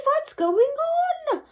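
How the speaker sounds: high fretful teacher voice; teachers crying out. Acted. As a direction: wail it in worry. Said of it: "The teachers cry".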